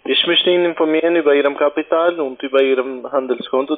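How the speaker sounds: ordinary talk, nothing marked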